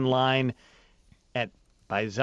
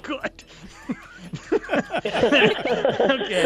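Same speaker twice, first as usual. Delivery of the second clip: laughing; 8 semitones higher, much slower than usual. Real, not acted. Host laughs at answer.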